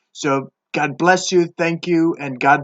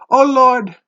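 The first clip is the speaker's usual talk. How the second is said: raised, loud plea to the Lord; pleading sailors; 7 semitones higher, 6 dB louder than usual. Acted. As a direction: cry out to the Lord for mercy. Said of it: "they pleaded".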